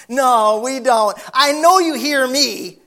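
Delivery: loud, insistent back-and-forth; loud insistence. Retold or imitated. Insisting to the neighbors they hear him.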